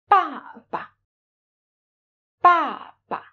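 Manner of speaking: "Ba ba" with a falling first syllable and a short, light, toneless second; father, "Ba ba" with light second syllable; neutral tone baba. Shown as a demonstration. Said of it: "you lose this stress".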